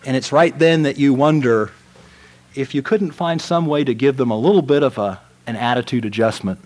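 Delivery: ordinary talk, nothing marked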